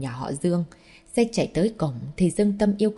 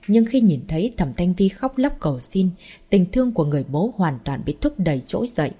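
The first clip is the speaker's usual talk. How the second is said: ordinary delivery, nothing marked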